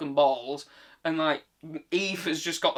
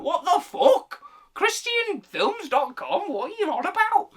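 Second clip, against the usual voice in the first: incredulous outburst; 4 dB louder than usual. Acted. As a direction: squawk it in comic outrage. Acted outraged reply to the review.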